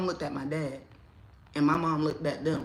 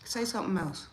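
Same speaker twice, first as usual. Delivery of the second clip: muttered warning; 3 semitones higher, 7 dB quieter than usual. Retold or imitated. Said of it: "Only I can talk to him".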